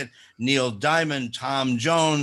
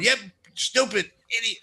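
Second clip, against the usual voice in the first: lightly voiced higher-pitched wife; mock a fed-up wife; grumbling wife; 7 semitones higher than usual. Wife scolding over a failed Zoom login, mock irritation. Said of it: "the wife is going".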